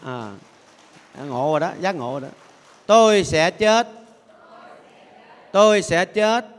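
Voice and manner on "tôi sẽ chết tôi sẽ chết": to, dứt khoát Tôi sẽ chết; hô to như bảo đại chúng đọc lớn. Shown as a demonstration. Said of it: "đọc lớn lên". (đọc lớn cho cả chùa nghe)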